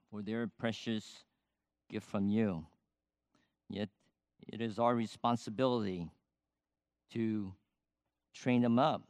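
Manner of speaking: low, soft, halting prayer; closing prayer. Real, not acted. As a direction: pray quietly and humbly for the parents.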